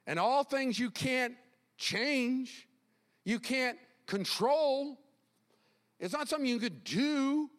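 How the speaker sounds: high, insistent pulpit delivery; emphatic preaching. Real, not acted. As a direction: press each point on the congregation. Preaches at raised pitch.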